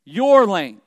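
YOUR drawn out and loud; stress on YOUR. Shown as a demonstration. Preacher telling the congregation whose lane, stress on YOUR. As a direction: punch the word YOUR. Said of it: "Let me emphasize that word".

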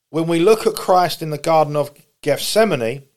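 ordinary talk

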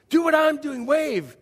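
put-on loud, high, over-eager coaxing of a baby; mock crazy parent, loud and high. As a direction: coax a baby with hammed-up excitement. Adults coaxing a baby to wave.

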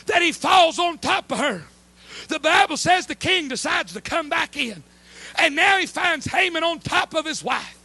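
loud, building preacher's cry; full-force preaching. Acted. Preacher thundering to the congregation about God's hand. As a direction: preach it to the rafters.

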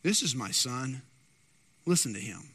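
lightly voiced God, grave and low; a hint of a booming divine voice. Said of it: "God from the heavens speaking and saying".

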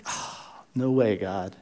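breathless, half-whispered dismay; a hushed, stunned plea to God; anxious gasp. Retold or imitated. A pastor hearing bad news, quiet breathy dismay. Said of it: "anxious response".

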